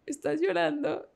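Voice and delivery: put-on high, small voice of a toddler asking; little girl voice. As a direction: play the little daughter, curious and innocent. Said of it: "Laila me ve y me dice". (voz aguda de niña pequeña preguntando)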